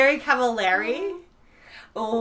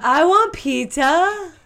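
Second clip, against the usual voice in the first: louder, slow tipsy whine; 5 semitones higher, 7 dB louder, much slower than usual. Real, not acted. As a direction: slur it like a tipsy friend. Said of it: "You really sound like a mess".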